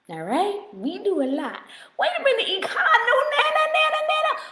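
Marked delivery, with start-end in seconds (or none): 2.0-4.4 high, playful protest; mock outrage. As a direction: pretend shock at being called Nana. Mock protest at being called Nana.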